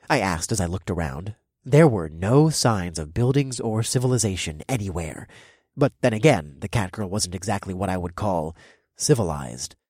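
ordinary delivery

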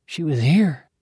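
usual speaking voice

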